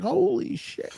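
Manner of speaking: very high, drawn-out amazed squeal; mock astonishment. Viewer spotting George Lucas in the background, astonished.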